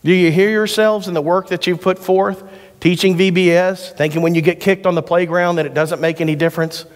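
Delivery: ordinary delivery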